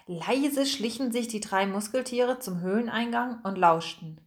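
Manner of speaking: usual speaking voice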